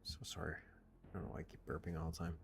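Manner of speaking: hushed embarrassed whisper. Real, not acted. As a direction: whisper an apology, unvoiced. Apologizing after burping on stream.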